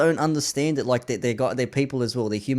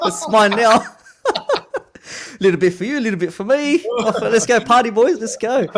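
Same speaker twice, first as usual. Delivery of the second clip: higher, louder, laughing party call; gleeful mock greed; 9 semitones higher, 5 dB louder than usual. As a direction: ham up the greedy glee, rallying the boys. Joking about keeping the drugs.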